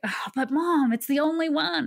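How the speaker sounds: high-pitched plea to Mom; pleading daughter. Retold or imitated. Daughter begging mom for the only course, high pleading. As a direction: plead upward like a daughter begging her mother.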